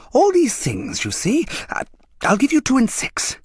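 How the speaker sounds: high, wheedling junk dealer voice; coaxing dealer. A narrator's reading. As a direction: sell it like a smooth street trader. Dealer offering price.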